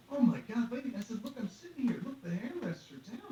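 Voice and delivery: high, loud exasperated pleading; exasperated protest. Retold or imitated. Seated passenger arguing with ticket agent, exasperated.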